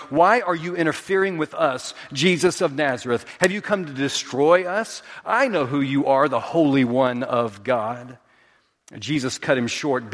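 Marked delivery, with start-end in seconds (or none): none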